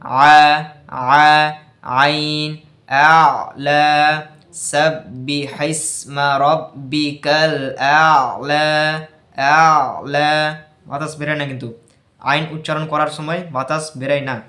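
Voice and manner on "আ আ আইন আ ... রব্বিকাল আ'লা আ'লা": আ and আ'লা pushed out firmly and a little louder from the throat; slow সাব্বিহিসমা রব্বিকাল, then আ'লা with a strong voiced আ; jahr demo. Shown as a demonstration. Teacher demonstrating আইন in a Quran verse, firm throat push. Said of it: "আইন বলার সময় বাতাস বেরাবে না".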